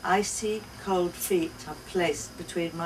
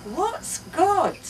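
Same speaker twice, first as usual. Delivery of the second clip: put-on much higher, louder little girl; high child's question; 12 semitones higher, 6 dB louder than usual. A narrator's reading. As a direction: ask like a curious toddler. Child asking.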